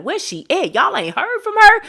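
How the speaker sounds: high, loud nosy relative voice; nosy cousins. Retold or imitated. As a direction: squeal it like a gossiping cousin. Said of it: "they probably like".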